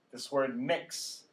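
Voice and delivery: "mix" said slowly, short I stressed; model word. Shown as a demonstration. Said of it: "Short I".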